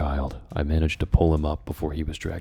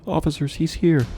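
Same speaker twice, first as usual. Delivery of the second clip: urgent warning; 12 semitones higher, 5 dB louder than usual. Acted. Reread warning.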